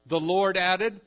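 "Lord" punched out loud and high; stress on Lord. Shown as a demonstration. Preacher showing who did the adding, loud stress on "Lord". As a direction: ring out "Lord" as the subject. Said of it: "always on God or the Lord".

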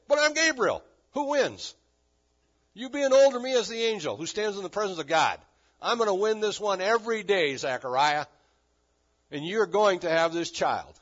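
loud, high, insistent rebuttal; forceful comeback. Acted. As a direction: overrule the old man as the angel. Acts Gabriel winning the argument.

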